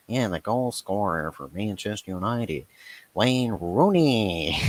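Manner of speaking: slow nasal stadium announcer, drawn out; old game announcer with a nasal drawl. Retold or imitated. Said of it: "the most nasally voice".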